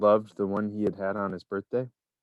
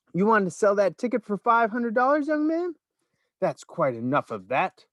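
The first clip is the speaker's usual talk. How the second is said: shout; 10 semitones higher than usual. A narrator's reading. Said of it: "the fat shopkeeper shouted".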